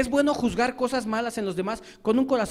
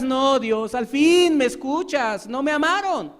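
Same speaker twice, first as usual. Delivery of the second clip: a higher, slow, self-pitying plea; complaining believer; 5 semitones higher, 4 dB louder, slower than usual. Acted. A believer complaining to God at judgment, self-pitying. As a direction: whine to God about being unloved.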